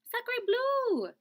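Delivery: high, loud dramatic exclamations; a flustered duck throwing up her wings; high indignant outburst; duck exclaiming. Acted. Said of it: "cried the duck".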